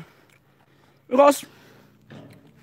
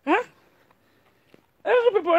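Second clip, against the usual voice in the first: usual speaking voice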